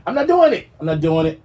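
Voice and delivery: high, loud I'm not doing it; emphatic refusal. Real, not acted. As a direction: shriek the refusal. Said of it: "repeated refusal".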